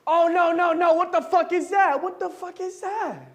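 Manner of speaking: high loud shocked yell; shocked outburst. Acted. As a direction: shriek in alarm.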